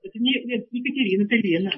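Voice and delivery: faint, small voice down the phone; barely audible, timid reply; squeaky quiet voice. Real, not acted. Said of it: "Ну что пищишь?".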